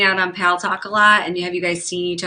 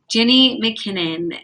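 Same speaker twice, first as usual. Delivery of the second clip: normal talking voice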